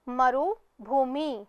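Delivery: मरुभूमि said slowly, every syllable drawn out; slow, careful blend. Shown as a demonstration. Teacher to pupils, slowly blending मरुभूमि. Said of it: "तीसरा शब्द है".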